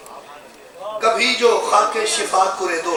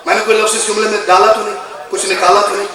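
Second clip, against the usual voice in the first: ordinary talk, nothing marked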